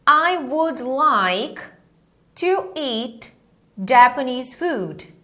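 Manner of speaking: I would like to eat Japanese food spaced out very slowly; teacher leading a repeat-after-me drill; slow drill phrase. Shown as a demonstration. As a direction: lay out each word for the class to echo. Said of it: "Vamos repetir comigo".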